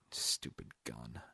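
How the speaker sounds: low, slow mutter. A narrator's reading. Roop looks at the pistol, muttering to himself. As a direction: mutter it under your breath. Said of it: "looked at the pistol and muttered".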